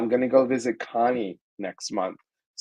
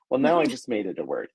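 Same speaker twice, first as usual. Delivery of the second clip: ordinary talk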